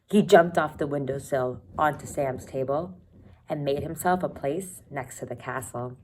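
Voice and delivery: ordinary delivery, nothing marked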